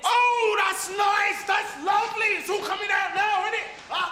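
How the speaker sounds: sarcastic shout. Acted. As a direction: yell it back, mocking and wounded. Heated quarrel with doctor.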